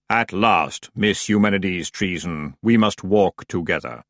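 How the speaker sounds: slow, solemn, even intonation; voice of Death. Acted. Death leading the witch away, slow and solemn. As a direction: intone the summons gravely. Death summons Miss Treason.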